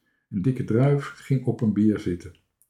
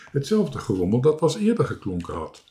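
ordinary delivery, nothing marked

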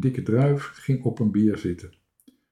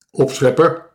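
louder, higher jeer; calling out; 5 semitones higher, 8 dB louder than usual. A narrator's reading. Dikke Druif to boasting Toontje, loud jeer. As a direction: throw it out as a loud taunt. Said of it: "riep Dikke Druif".

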